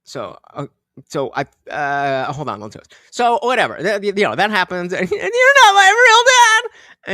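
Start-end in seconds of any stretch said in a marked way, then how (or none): 5.1-7.1 high, loud, drawn-out yell; mock outburst. Joking about being treated like a kid, mock yell. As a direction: wail it like a protesting kid.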